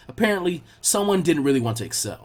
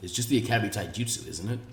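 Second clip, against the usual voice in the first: lower, softer sensei voice, unimpressed; skeptical sensei; 8 semitones lower, 9 dB quieter than usual. Acted. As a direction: wonder aloud what's special here. Asuma's skeptical thought.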